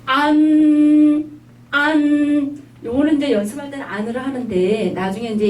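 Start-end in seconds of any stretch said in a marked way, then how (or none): 0.1-2.6 안 drawn out long, high and loud; slow 안 with a nasal end. Shown as a demonstration. Teacher modelling a long nasal 안. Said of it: "해보세요".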